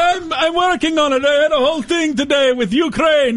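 high defensive whine; whiny husband. Pretend. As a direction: make excuses like a nagged husband. Henpecked husband answers wife.

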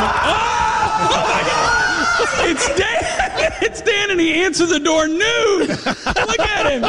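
high laughing shrieks of shock; shrieking laughter. Real, not acted. Finding Dan answering door nude.

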